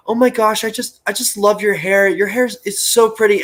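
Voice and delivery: mild mock gush of an admiring mom. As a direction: gush over the hair like a starstruck mom. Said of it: "the mom goes".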